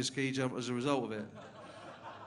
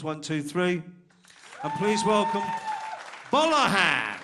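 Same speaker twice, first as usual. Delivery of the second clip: announcer introduction; 7 semitones higher, 11 dB louder, slower than usual. Real, not acted. MC introducing next act.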